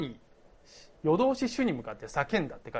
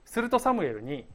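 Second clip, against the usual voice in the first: ordinary talk, nothing marked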